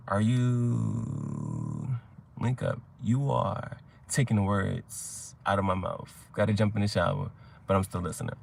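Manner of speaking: low, slow and soft, half to yourself; reading chat aloud. Real, not acted. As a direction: read messages slowly under your breath. Reading out viewers' chat comments.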